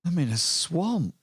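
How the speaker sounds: put-on dazed lost boy; NPC voice. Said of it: "he says".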